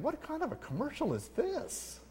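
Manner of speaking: mock bewildered listener; baffled thought. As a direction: puzzle it out in disbelief. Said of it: "I'm thinking".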